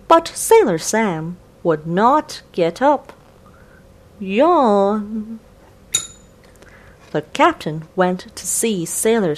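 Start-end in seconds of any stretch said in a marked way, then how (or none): 4.2-5.4 long drawn-out yawn; sleepy yawn. A narrator's reading. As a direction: yawn like you just woke up. Lazy sailor yawns.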